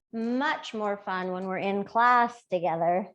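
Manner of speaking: ordinary talk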